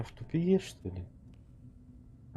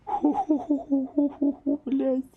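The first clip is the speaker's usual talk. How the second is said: panicked panting; 14 semitones higher than usual. Real, not acted. Fleeing, panting in fear.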